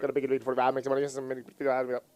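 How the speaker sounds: fast auctioneer patter. Acted. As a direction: rattle it off like an auctioneer. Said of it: "I was talking to my auctioneer voice".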